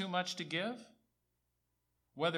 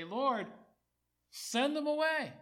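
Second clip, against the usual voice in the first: higher, louder cry of the doubting disciples; acted-out urgent appeal; loud mock plea; 11 semitones higher, 6 dB louder, slower than usual. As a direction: call it out high and loud, imploring the Lord.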